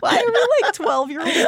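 very high giggling voice; laughing. Real, not acted. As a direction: giggle like a schoolgirl. Giggling friends.

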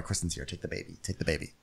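quiet, hurried hush. Retold or imitated. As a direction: hush it quickly. Hushed handoff of baby.

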